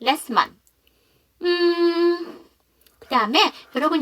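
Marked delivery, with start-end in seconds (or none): 0.0-0.5 "last month" with the T left out, like "las month"; dropped middle consonant. Shown as a demonstration. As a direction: skip the T in "last" before "month". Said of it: "두 번째 생략".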